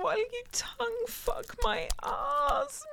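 high moaning cry. Acted. Cries, high pitch.

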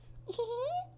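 tiny high-pitched giggle. Shown as a demonstration. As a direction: giggle in the little voice. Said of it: "the little voice".

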